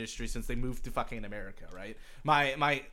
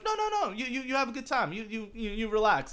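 friendly insisting; 9 semitones higher than usual. Retold or imitated. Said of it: "people are just like".

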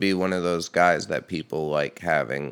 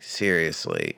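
ordinary talk, nothing marked

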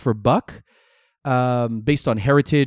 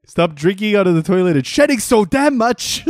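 mock scolding dog owner; 7 semitones higher, 4 dB louder than usual.

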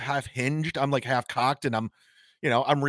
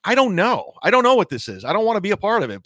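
exasperated; 6 semitones higher, 5 dB louder than usual. Retold or imitated. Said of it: "I'm like".

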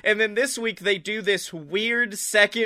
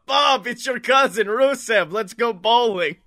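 loud Bulgarian cousin on the phone; Bulgarian accent, GTA cousin; 4 semitones higher, 4 dB louder than usual. Retold or imitated. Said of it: "Like fucking G T A four".

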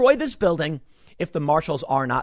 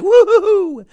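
very high, very loud party whoop; mock celebration whoop; 12 dB louder, slower than usual. Protesters celebrating the shooting, hammed-up victory whoop. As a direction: whoop like they've won.

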